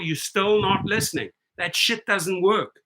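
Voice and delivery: ordinary delivery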